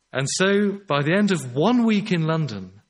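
ordinary talk

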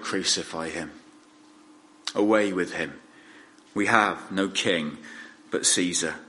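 ordinary delivery

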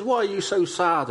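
high, tender question to grieving friends; Jesus gently asking his sad friends; gentle concern. Retold or imitated. Risen Jesus asks grieving disciples.

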